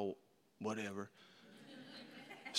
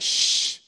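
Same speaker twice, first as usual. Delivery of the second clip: hushing shh. Retold or imitated. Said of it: "he was like".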